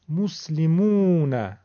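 مسلمون slow, short 'mus', long 'moona'; damma then vav. Shown as a demonstration. Teacher showing both vowels, long 'oo' in مسلمون. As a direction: hold only the 'oo' of مسلمون. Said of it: "این میم اول ضمه داره".

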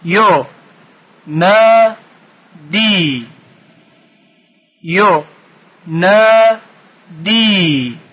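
"یو، نا، دی" in separate, held beats; syllable by syllable. Shown as a demonstration. Teacher splitting the next word, syllable by syllable. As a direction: chant each syllable alone with pauses between.

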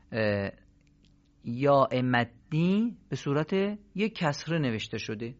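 usual speaking voice

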